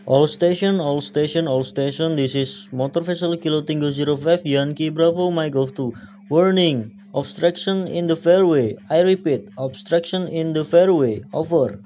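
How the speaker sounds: loud warning call to all stations; loud urgent radio broadcast. Shown as a demonstration.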